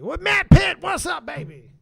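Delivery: excited shout. Real, not acted. Greets supporter at milestone.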